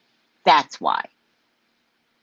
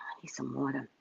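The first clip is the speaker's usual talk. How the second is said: whispered aside; 7 semitones lower, 15 dB quieter, faster than usual. Real, not acted. Host asking for water, whispered. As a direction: whisper off-mic, unvoiced.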